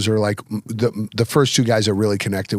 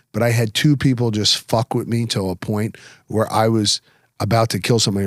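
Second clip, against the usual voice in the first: usual speaking voice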